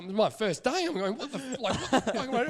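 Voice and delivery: high-pitched, hammed-up dismay; mock panic. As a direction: retell being flustered through laughter. Retells his panic on first day.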